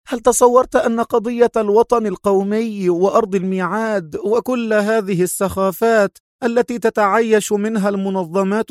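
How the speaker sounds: high, scornful villainess tirade; mocking. A narrator's reading. Sonia derides Adham as deluded, mocking. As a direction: scoff at him in a high voice. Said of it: "ضحكة ساخرة قوية مضيفة".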